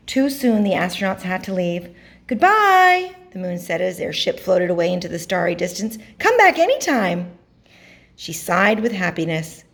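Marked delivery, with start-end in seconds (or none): 2.3-3.1 high, loud, drawn-out goodbye; farewell call. A narrator's reading. Moon calls after departing ship. 6.2-7.3 high-pitched call into the distance; inviting farewell call. A narrator's reading. Moon calls after departing ship.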